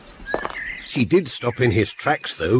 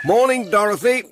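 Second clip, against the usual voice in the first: higher, bright called greeting across the yard; calling out; 9 semitones higher than usual. Acted. Pat greets Dorothy at Thompson Ground, called out high. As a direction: call a greeting to a neighbour. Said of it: "Pat called out".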